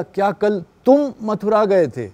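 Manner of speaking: तुम lifted high, the rest falling after it; तुम as the high peak of the question; stress on तुम. Shown as a demonstration. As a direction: hit तुम, questioning who went. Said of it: "कि तुम ही गए या कोई और चला गया".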